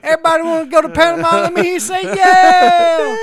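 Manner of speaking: high, fast, loud hype-man call; hype man shout. Pretend. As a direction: pump up the crowd, yelling. Hype man rallying the passengers.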